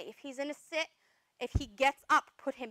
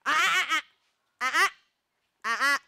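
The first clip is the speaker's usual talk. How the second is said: loud clipped ah ah ah; sharp correction; 9 dB louder than usual. Real, not acted. Trainer stops the dog from breaking a stay, sharp and loud. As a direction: bark out short warning sounds. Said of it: "I use it as a reminder".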